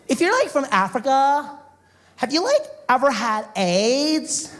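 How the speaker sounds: high, slow clueless question; mock-innocent girl, drawn out high; ditzy girl voice. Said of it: "she goes".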